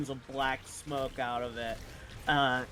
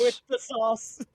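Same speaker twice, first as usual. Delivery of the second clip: hyped echo; 8 semitones higher, 6 dB louder than usual. Acted. Echoing friend's hype phrase.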